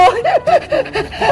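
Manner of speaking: a bright giggle that climbs then falls; mischievous laugh. Acted. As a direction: laugh at your own prank. Mischievous laugh before spraying. (pilyong tawa)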